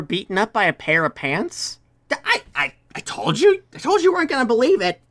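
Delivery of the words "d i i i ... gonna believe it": higher, fast, stammering kobold protest; Larry insisting he warned them, higher and faster; flustered kobold. Acted. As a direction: sputter out the excuse. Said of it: "And Larry goes".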